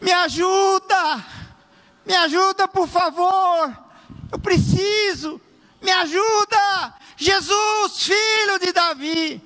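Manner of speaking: high, slow, pleading cry to God; desperate crying out. Shown as a demonstration. Pastor demonstrating clamor, high slow desperate plea. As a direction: beg for help with all you have, high and drawn out. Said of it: "Ou clamar é assim".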